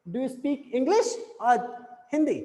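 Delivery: "English" rises, "Hindi" falls; rising then falling. Shown as a demonstration. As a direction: slowly lift "English", pause, drop on "Hindi". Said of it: "English पे आपका rising tone है". ("English" पर चढ़ता, "Hindi" पर गिरता स्वर)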